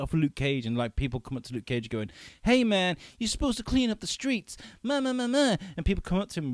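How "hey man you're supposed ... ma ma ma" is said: mocking stilted extras, much higher; bad acting. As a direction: overdo a cheesy TV bit part. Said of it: "really bad acting".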